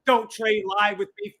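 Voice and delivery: ordinary talk, nothing marked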